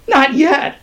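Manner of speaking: loud, high protest; high, urgent appeal; pleading. A narrator's reading. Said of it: "pleaded Skinner".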